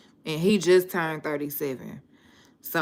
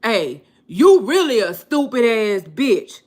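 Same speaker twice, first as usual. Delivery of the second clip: loud, high imitation of the brother cursing out a lady; mock yelled insult; 6 semitones higher, 9 dB louder than usual. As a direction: bark it out like him shouting at her. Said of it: "he gonna say".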